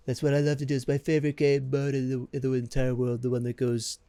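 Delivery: hammed-up excited announcer; silly sing-song voice. Repeats in put-on voice.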